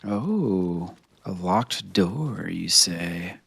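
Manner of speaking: low, slow, hushed musing; playfully sly. Pretend. Streamer finding a locked door, hushed mock intrigue. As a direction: draw it out softly, dropping near a whisper.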